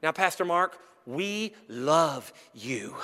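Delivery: low, slow, soft dread; ominous lead-in. Retold or imitated. A member starts a hard talk with the pastor, low and slow. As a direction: drag it out like bad news. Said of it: "they say something like this".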